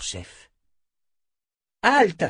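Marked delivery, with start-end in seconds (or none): 1.8-2.2 loud high shout through cupped hands. A narrator's reading. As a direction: call out to stop the rider far off. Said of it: "cria Beata, les mains en porte-voix".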